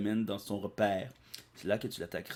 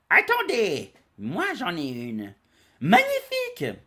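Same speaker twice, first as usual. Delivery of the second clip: excited exclamation; 9 semitones higher, 8 dB louder, slower than usual. Retold or imitated. Excited characters in retelling.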